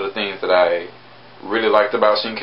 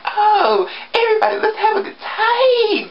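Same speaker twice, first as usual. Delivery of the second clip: very high, sugary mock-cheerful voice, far above her own; mock the actress's cutesy put-on cheer; cutesy girly voice. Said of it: "that cutesy".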